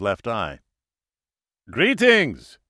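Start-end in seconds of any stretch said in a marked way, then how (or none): 1.7-2.6 put-on high, loud German-accented greeting; slight German accent. A narrator's reading. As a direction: hail a visitor with a light German accent. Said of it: "he said with a slight accent".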